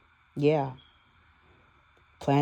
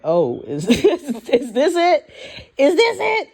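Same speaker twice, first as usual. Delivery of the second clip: laughing disbelief climbing into a high, loud near-cry; retold panic; 11 semitones higher, 6 dB louder than usual. Recalling her blood pressure scare, a panicked high cry. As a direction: voice breaks upward as the fear hits. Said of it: "I was like".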